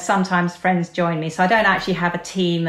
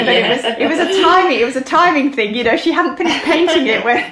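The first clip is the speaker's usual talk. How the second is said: higher and louder, laughing through the words; 6 semitones higher, 6 dB louder than usual. Real, not acted. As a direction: laugh as you tell it. Laughing while recalling the coincidence.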